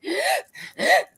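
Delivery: high startled gasp; sharp gasp. Real, not acted. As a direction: suck in air suddenly. Gasp mid-sentence about panic.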